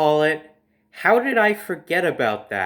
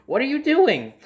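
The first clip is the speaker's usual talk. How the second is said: aunt surprised; 10 semitones higher than usual. Retold or imitated. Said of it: "She's like".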